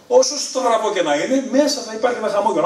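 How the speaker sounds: the comedian urging the audience not to give up, rising and emphatic; earnest encouragement. Real, not acted. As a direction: lift the audience with hope. Uplifting message to audience.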